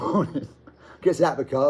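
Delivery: usual speaking voice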